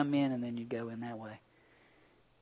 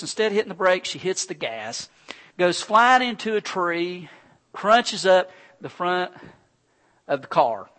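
usual speaking voice

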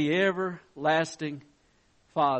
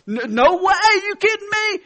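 louder and higher, you can't be serious; incredulous; 12 semitones higher, 11 dB louder than usual. Retold or imitated. Customer refuses the mechanic, much higher in disbelief. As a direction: pitch leaps way up in disbelief.

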